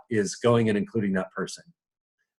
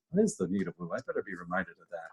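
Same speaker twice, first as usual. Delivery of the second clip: very soft, dropped-voice self-reminder; hushed thinking-aloud aside; 6 semitones lower, 12 dB quieter, faster than usual. Real, not acted. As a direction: say it under your breath, to yourself. Aside to self, checking what was forgotten.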